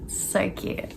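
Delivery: soft unvoiced whisper; whispered gush. Real, not acted. Vlogger to viewers about the couple, whispered gush. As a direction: hush it out fondly.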